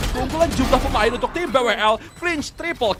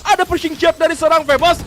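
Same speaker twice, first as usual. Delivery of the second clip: loud, high hype call; excited shout; 7 semitones higher, 7 dB louder than usual. Real, not acted. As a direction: yell it out like a caster at a clutch play. Caster hypes a big play.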